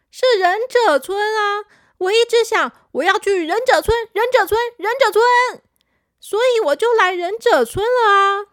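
high, loud, quick insistence; insistent child. Acted. Confused boy insisting to his friend he came to 忍者村, high and loud. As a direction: stamp his point home.

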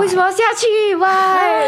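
spooked listener protesting the story; distressed outcry. Real, not acted. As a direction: cry out in real dismay. Distressed reaction to girl leaving train.

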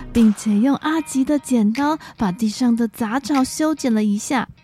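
ordinary delivery

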